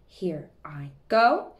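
ordinary talk

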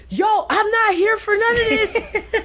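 laughing protest. Retold or imitated. Dancer protesting being made captain, laughing high. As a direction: protest while cracking up. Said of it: "And I'm like".

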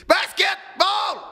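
high, drawn-out mock yell of "Basketball!"; retelling the woman in crisis screaming a random word, a high drawn-out shout; imitated scream. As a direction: belt it out like someone in crisis, stretched and high. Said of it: "elle va juste crier".

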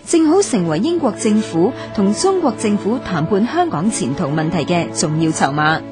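ordinary talk

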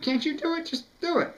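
high voice quoting a friend; mimicking a friend. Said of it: "he was like".

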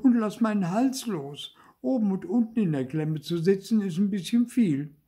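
high, exasperated puppet complaint; crying out. A narrator's reading. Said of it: "schrie Zäpfel". (hoch und genervt rufend)

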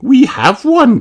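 high, loud teasing mum; playful correcting mother. A narrator's reading. Mummy Pig voiced.

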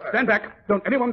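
urgent shouted warning; sharp warning shout. Acted. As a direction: bark it to clear the room. Hypodermic breaks, Holmes warns everyone.

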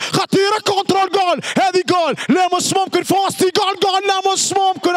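high shouted goal and disbelief; goal call. Real, not acted. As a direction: scream the goal.

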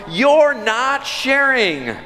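put-on high, slow whining little boy; whiny child. Preacher imitates his son accusing his sister, high slow whine. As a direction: whine it like a wronged toddler. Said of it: "Eli retorts".

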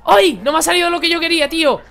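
loud high frustrated outburst; fast, high, loud groan of frustration; frustrated cry. Real, not acted. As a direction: yell it out as the move fails. Failed play in the match.

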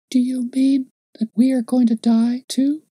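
put-on high child's voice, a touch tearful; tearful little girl. A narrator's reading. Little girl asks the man if they will die, high child voice. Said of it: "checking her sobs and raising her tear-stained face".

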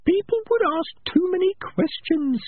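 slow, tiny, very high rat voice; Peaches. Acted. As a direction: object in a careful little squeak. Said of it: "the voice of Peaches".

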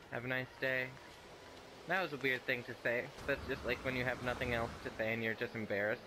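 ordinary talk

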